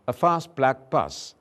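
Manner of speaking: "fast" with a long British ah vowel; British English. Shown as a demonstration. Teacher contrasting British and American English. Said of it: "the British version".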